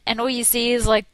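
ordinary talk, nothing marked

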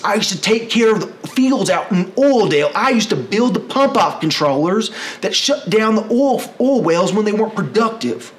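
high, loud, urgent appeal; oil worker urging Republicans, loud and high; earnest pitch. Retold or imitated. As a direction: plead with Republicans as an oil man. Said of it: "We could talk to them and say".

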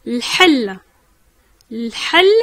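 L'hal said with the A barely heard; Arabic word hal. Shown as a demonstration. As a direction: say L'hal, almost swallowing the A. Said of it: "le A, on l'entend quasiment pas".

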